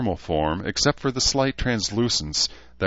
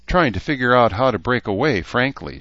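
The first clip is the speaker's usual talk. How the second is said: ordinary delivery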